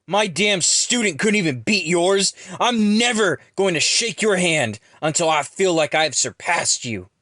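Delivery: high, loud, frustrated rant, easing on the last words; aggravated yelling. A narrator's reading. Ron admits always losing to Jackson, yelling in aggravation. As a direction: yell it out, exasperated and furious. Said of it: "becomes more aggravated and yells".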